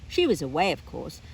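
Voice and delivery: ordinary delivery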